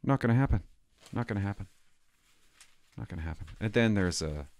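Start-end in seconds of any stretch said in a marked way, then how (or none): 0.0-3.5 repeated refusal dropping softer each time; fading refusal. Real, not acted. Refusing to learn manners, fading to near silence. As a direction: trail off while refusing.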